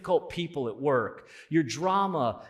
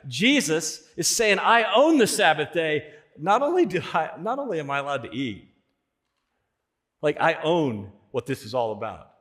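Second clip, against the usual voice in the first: louder, rising proclamation that builds; full-force preaching; 4 semitones higher, 6 dB louder than usual. Acted. Preacher proclaiming Jesus' claims to the congregation, full force. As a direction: preach it like a declaration.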